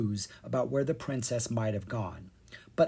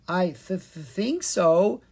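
halting, slow uncertain stammer; nervous stutter; stammering hesitation; 6 semitones higher, 5 dB louder, slower than usual. A narrator's reading. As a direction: stumble over the lie. Leon hesitating, unsure.

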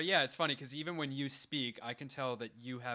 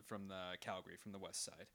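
normal talking voice

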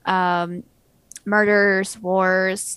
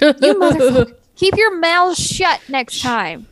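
loud mock scolding, slow at the end; mock angry yell; 6 semitones higher, 7 dB louder than usual. Yelling at past people.

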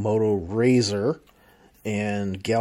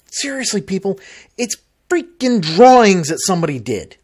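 exasperated rant; 6 semitones higher, 5 dB louder than usual. Real, not acted. Complaining rant about renders.

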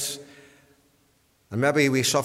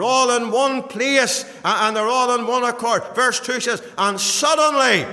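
high, loud pulpit climax; full-force preaching; 11 semitones higher, 9 dB louder than usual. Real, not acted. Preacher recounting Pentecost to the congregation, fervent. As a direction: build excitement with a ringing cry.

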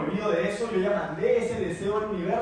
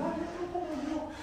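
much higher, softer mock voice; 11 semitones higher, 6 dB quieter than usual. People watching you vibrate, a squeaky mocking voice. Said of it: "y dicen".

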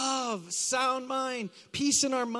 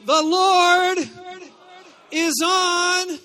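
fervent declaration; 8 semitones higher, 12 dB louder, much slower than usual. Shown as a demonstration. Preacher rallying congregation to repeat the verse, fervent shout. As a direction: shout it high, each phrase set apart. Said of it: "like it actually means something to you".

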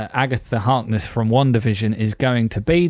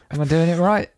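ordinary delivery

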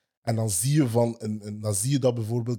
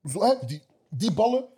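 mocking; 10 semitones higher than usual. Real, not acted. Mocking Harry's passes, high pitch.

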